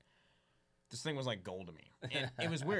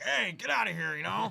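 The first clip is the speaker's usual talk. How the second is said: put-on higher, louder gruff yell at kids; grumpy old fisherman; 8 semitones higher, 8 dB louder than usual. Old fisherman chasing kids off the docks, hammed-up angry yell. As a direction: shoo the kids off the docks like a cranky old man. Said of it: "Grumpy crab fisherman".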